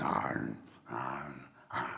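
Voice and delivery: very low munching growl; bear eating noises. Acted. Storyteller plays Bear sitting there eating, munching noisily. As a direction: imitate a bear chomping away.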